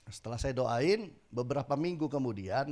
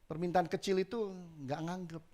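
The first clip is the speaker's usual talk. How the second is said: normal talking voice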